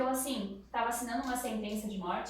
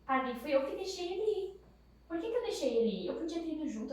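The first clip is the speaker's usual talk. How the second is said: anguished; 6 semitones higher, 4 dB louder than usual. Retold or imitated. Mãe culpada implora ao padre.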